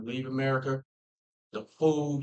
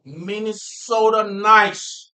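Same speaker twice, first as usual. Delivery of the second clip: put-on slow, drawn-out Minnesota accent; 5 semitones higher, 7 dB louder, much slower than usual. Proud Minnesotan naming the local kindness, Minnesota accent. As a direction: stretch it loud and high in a Minnesota accent. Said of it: "Minnesota accent".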